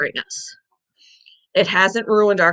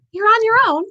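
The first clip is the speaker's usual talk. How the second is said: mock sing-song dismissal; 14 semitones higher than usual. Mocking the hospital's stance.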